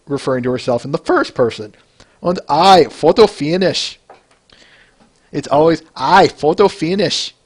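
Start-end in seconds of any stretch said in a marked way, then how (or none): 2.2-4.0 bad German accent, louder; hammy German accent; terrible German accent. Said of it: "I'm doing a terrible German accent there". 6.0-7.3 slow, bad German accent; hammy German accent; terrible German accent. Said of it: "I'm doing a terrible German accent there".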